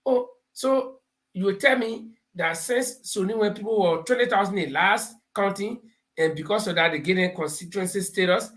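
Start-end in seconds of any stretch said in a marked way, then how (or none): none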